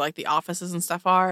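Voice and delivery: ordinary delivery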